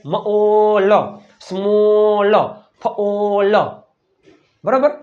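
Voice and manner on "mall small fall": મોલ, સ્મોલ, ફોલ each with a long 'o'; sounded out. Shown as a demonstration. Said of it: "હવે તમને આવડી જાય". (મોલ, સ્મોલ, ફોલ લંબાવીને)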